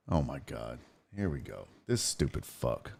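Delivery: a quiet aside cursing the troll; muttered under breath. Real, not acted. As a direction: grumble it almost inaudibly off-mic. Muttering to himself about a troll.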